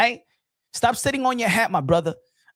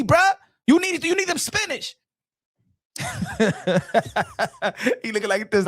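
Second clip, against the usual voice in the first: normal talking voice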